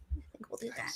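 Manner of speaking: unvoiced sly whisper. Real, not acted. Host whispers a scheming aside to the listeners. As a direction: breathe it as a secret aside.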